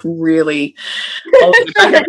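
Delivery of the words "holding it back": laughing. Real, not acted. Husband tearing up, laughing.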